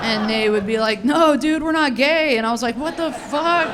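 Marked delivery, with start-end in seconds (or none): 1.0-2.4 alarmed refusal. Retold or imitated. Guys refusing her offer. 2.8-3.7 indignant outburst. Retold or imitated. Rejected teen reacts.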